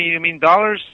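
ordinary delivery

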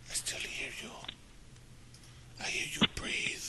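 breathy, menacing whisper right into the phone; hushed creepy caller whisper. Acted. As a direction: whisper it like a stalker on the line.

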